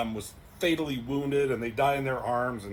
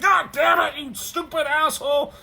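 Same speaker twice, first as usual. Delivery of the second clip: much higher, loud enraged yell; furious shout; 12 semitones higher, 7 dB louder than usual. Acted. Terrax screaming at Surfer for refusing to kill, furious shout. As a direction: explode at him in rage.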